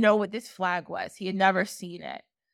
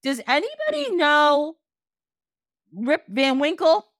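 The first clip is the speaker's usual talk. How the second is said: raised-pitch retold cry to a crowd; half-hearted scream, drawn higher; mild mock scream; 4 semitones higher, 3 dB louder than usual. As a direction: call out in mock desperation. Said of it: "he just screams".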